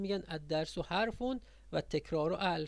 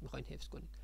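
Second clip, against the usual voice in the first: ordinary talk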